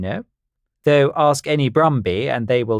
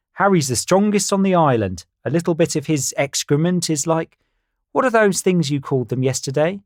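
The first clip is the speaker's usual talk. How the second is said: high bird voice; 7 semitones higher than usual. Acted. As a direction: play a silly know-it-all bird. Carl voiced in story.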